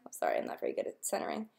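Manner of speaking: under the breath, fast and soft; a half-whispered sorry while fiddling with the camera; quick muttered aside. Real, not acted. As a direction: toss off a quick whispered apology to yourself. Muttered apology aside while filming.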